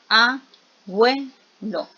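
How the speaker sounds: "abuelo" slowly broken as a, bue, lo; split into syllables. Shown as a demonstration. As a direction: say "abuelo" one syllable at a time. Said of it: "donde yo digo". ("abuelo" lento, en sílabas: a, bue, lo)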